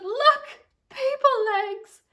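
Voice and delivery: put-on mother thrilled to see her baby; delighted mother. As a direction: show pure relief.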